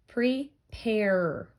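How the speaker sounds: "Prepare" as pre, then a lengthened pairrr; pre... pairrr, drawing out the final R; sounded-out syllables. Shown as a demonstration. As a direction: split "Prepare" and hold the er sound at the end. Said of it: "We still hear the er sound".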